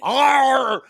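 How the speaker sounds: loud, high mock roar; mouth-full roar. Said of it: "got all of it in his mouth".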